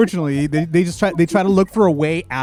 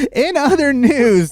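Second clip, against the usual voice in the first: mock news anchor; 8 semitones higher, 4 dB louder, slower than usual. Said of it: "mock newscaster segue".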